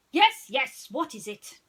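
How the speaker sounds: eager sleuth answering the door; impatient Basil. Acted. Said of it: "he asked".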